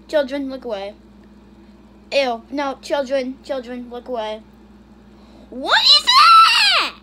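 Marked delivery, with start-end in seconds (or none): 5.5-6.9 high, slow, drawn-out yell of disbelief; horrified shout. Real, not acted. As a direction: scream the question in horror. Shouting in disgust at clip.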